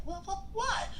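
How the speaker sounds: high, baffled disbelief; incredulous why. Retold or imitated. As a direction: squeak out a stunned why. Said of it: "I'd be like".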